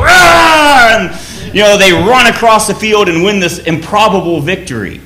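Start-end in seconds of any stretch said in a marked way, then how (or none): 0.0-1.0 loud, much higher roar; a whole army cheering as it charges; Scots' mock battle roar; army battle cry. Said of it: "they all go".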